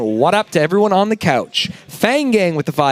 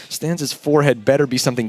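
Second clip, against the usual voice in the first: ordinary delivery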